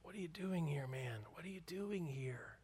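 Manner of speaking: very soft, almost whispered question. A narrator's reading. God whispering to Elijah in the cave, very soft and hushed. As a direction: hush it like God speaking gently. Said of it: "speaking to him softly in a whisper".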